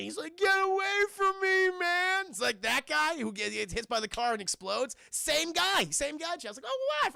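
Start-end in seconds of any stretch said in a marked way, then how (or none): 0.4-2.3 high, loud panicked yell; frightened shout. Retold or imitated. Sheriff shouts at the melting deputy, frightened. 6.6-7.1 surprised. Real, not acted. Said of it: "I totally forgot".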